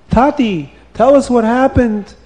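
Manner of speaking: high, loud eager call; eager children. A narrator's reading. Children begging father to tell what happened, excited.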